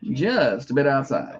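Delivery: dry announcer call. Retold or imitated. Quotes Uecker's famous call.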